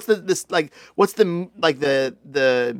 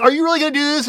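shocked; 11 semitones higher, 4 dB louder, faster than usual. Acted. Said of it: "he's shocked".

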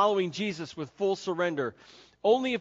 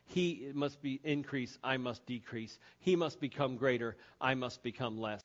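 soft refrain; 6 semitones lower, 7 dB quieter than usual. Real, not acted. Soft solemn repetition.

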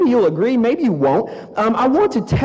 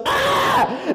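a sudden, much higher mock squeal of delight; imitating his wife's excited squeal. Wife grabs his arm at the flea market, playful squeal.

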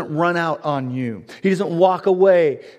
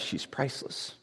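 hushed; 14 dB quieter than usual. Acted. Tender aside about Hosea's wife.

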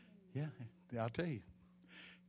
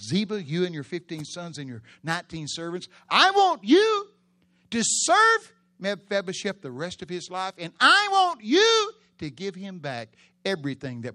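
royal command; 6 semitones higher, 13 dB louder than usual. Acted. King commanding servant.

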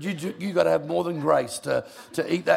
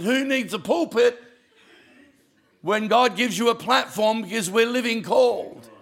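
raised, higher preaching punchline; 6 semitones higher, 3 dB louder than usual. Acted. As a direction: hit it like a sermon climax. Preaching punchline at full force.

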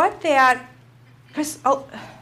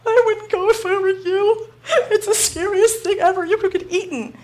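much higher, louder, laughing half-cry; mock scared warning; 8 semitones higher, 4 dB louder than usual. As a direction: ham up the terror while laughing.

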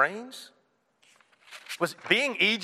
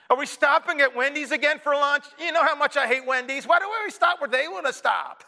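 higher, petulant road-trip whine; mock kid whine, pitched up; whining kid; 7 semitones higher than usual. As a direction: complain like a kid in the back seat. Kid whining about Wendy's.

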